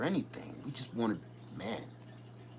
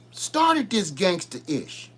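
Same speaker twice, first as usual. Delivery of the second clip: normal talking voice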